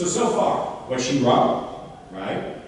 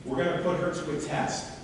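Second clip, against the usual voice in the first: ordinary delivery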